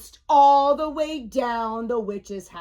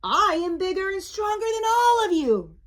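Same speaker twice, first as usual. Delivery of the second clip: high-pitched bragging mummy; puffed-up storybook boast; boastful; 9 semitones higher than usual. A narrator's reading. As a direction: brag grandly in a raised pitch. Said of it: "boasted the mummy".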